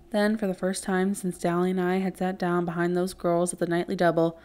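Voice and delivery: ordinary delivery, nothing marked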